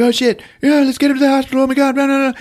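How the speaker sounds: high, racing, garbled panic; hammed-up frantic babble; mock panic. Ambulance crew rushing to hospital, mock panicked babble.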